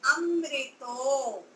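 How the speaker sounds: अमृतो said very slowly, syllable by syllable. Shown as a demonstration. Teacher sounding out the word at the board. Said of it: "शब्द क्या है हमारा?".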